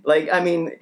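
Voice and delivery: usual speaking voice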